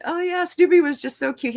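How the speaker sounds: high, playful fake-innocent voice; teasing mock innocence. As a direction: play dumb to tease him. Teasing Gorilla Man, mock misunderstanding.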